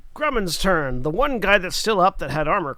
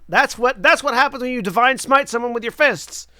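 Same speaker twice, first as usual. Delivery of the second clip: loud, higher, emphatic outburst; hyped exclamation; 8 semitones higher, 8 dB louder than usual. Real, not acted. Game master explains divine smiting with fists, hyped and loud. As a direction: punch it out with loud excitement.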